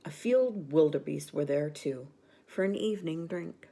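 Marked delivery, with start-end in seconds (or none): none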